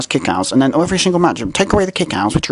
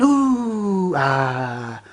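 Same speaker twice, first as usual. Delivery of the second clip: mock crowd "ooh, ah"; 4 semitones higher than usual. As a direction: imitate an easily impressed crowd. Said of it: "And you went".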